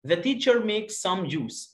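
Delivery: put-on slow, clear reading for children; slow teacher read-aloud; slow model reading. Shown as a demonstration. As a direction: read it out slowly, word by word. Teacher reads slowly for pupils.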